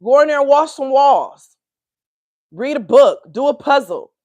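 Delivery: scolding grandma, high and loud; sassy old grandma fussing. Retold or imitated. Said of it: "I be hearing my grandma voice".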